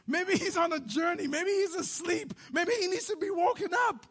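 high-pitched sarcastic speculation; mocking taunt. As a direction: needle them with mock-helpful guesses. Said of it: "Elijah starts mocking them".

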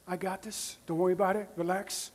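hushed, calm and gentle; soothing reassurance. Acted. God calming a parent worried about their son, hushed and soothing. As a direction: settle them down softly, no worry at all.

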